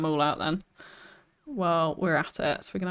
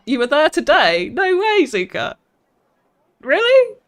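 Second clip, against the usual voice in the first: high, loud disbelief; amazed surprise; 9 semitones higher, 11 dB louder than usual. Real, not acted. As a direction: exclaim it in real astonishment. Learns viewer visited park today.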